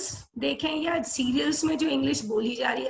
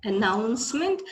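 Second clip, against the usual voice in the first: normal talking voice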